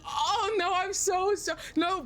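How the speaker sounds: high-pitched retold dismay; flustered apology. Apologizing to the coworker who sneezed, high flustered dismay. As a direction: pitch it up, stumbling over the apology.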